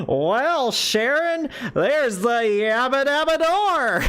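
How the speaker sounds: high, slow cartoon caveman voice; Fred Flintstone. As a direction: ham up a goofy caveman drawl. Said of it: "talking like Fred Flintstone".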